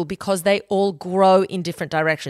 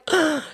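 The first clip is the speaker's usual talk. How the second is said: mock shocked gasp; 9 semitones higher than usual. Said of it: "this person's like".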